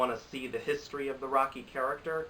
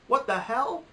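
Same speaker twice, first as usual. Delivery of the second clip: high, loud exclamation of disbelief; everyone's baffled reaction, high and loud; incredulous outburst; 12 semitones higher, 7 dB louder, slower than usual. Retold or imitated. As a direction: blurt it out high and loud, outraged. Acted shocked reaction to the snub.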